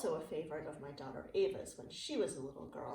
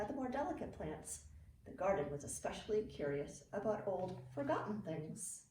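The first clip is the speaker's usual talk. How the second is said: put-on reading to children. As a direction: read it like a bedtime picture book for kids. Reading a picture book aloud.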